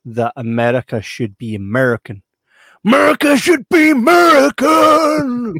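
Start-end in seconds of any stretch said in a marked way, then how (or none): 2.8-5.5 higher, louder, slow shouted slogan in a put-on voice. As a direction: bellow it like a rally chant. Racist slogan shouted in character.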